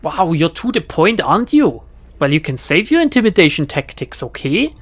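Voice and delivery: put-on German accent, pointed. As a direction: redo it with a clear German accent. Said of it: "Wait, German accent".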